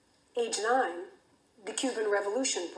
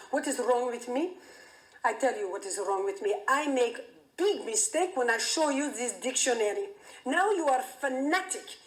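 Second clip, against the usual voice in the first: put-on accented, exasperated dad scolding; a worked-up father ranting at his fanatical daughter; agitated Greek father. Father to twelve-year-old daughter in the den, agitated accented rant. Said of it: "now he's agitated".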